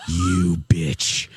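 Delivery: low, drawn-out envious jab; imitating a jealous partner; mock resentment. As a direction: say it low under your breath, jealous. Voices Colin's envious look.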